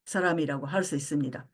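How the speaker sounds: ordinary talk, nothing marked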